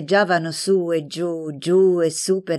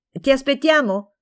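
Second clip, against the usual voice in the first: mild call, pitched up; 6 semitones higher than usual. A narrator's reading. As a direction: call a question to someone behind you. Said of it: "Le gridò Franca". (richiamo a voce alta, contenuto)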